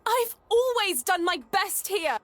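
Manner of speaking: high, loud and slow indignant cry; indignant protest. Acted. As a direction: protest the unfairness loudly. Girl protests to the headmaster.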